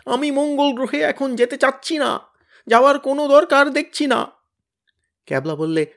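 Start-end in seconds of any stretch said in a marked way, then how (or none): 0.1-4.3 very high, loud terrified wail; sky-splitting wail. Acted. Tenida refuses to go to Mars, sky-splitting wail. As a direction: scream out the refusal in horror. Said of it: "আকাশ ফাটিয়ে আর্তনাদ করে টেনিদা বললে".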